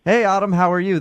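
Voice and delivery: ordinary delivery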